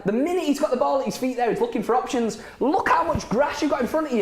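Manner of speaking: high-pitched fan complaint; exasperated. Real, not acted. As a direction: berate the player from the sofa. Exasperated fan berating a player.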